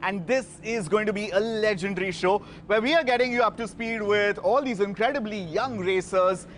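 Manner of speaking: usual speaking voice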